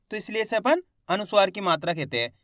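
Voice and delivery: ordinary talk, nothing marked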